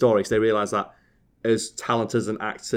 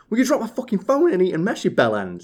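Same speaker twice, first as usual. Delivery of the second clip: high, fast-tempered Yorkshire rant, retold; mock-furious Yorkshire scolding; angry broad Yorkshire; 10 semitones higher, 3 dB louder than usual. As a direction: re-enact blowing up over a dropped phone. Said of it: "that angrily".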